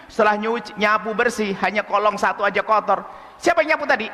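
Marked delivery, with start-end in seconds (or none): 3.4-4.1 higher, sharp demanding question; mother interrogating, accusing tone; harsh mother. Retold or imitated.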